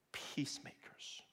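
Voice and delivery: slow, breathy whisper of peacemakers; hushed reverent whisper. Real, not acted. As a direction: let peacemakers drop to a whisper. Sermon climax word.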